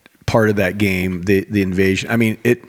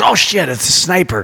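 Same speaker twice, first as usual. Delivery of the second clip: higher, mock alarmed yelp; panicked friend; 6 semitones higher than usual. Duped gaming buddy, mock panic at a hidden sniper. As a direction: play the friend who thinks a sniper got him. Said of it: "He goes".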